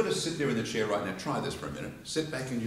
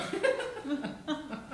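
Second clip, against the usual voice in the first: real high-pitched laugh; high laughing burst; high burst of laughter. Real, not acted. Audience exercise makes him laugh.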